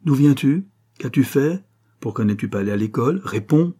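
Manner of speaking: voix plus haute et plus forte, pressante; père en colère. Acted. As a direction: interroger durement l'enfant fugueur. Parents en colère interrogent. (le père exige une réponse, voix forte)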